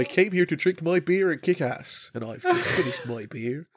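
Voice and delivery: badly faked Irish cowboy; terrible Irish accent. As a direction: put on a hammy Irish brogue. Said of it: "That was a terrible accent".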